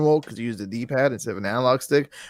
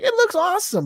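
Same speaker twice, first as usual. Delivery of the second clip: high, eager fan voice; lightly voiced excitement at a trailer; mock hype. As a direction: gush like a hopeful fan. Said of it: "I'm like".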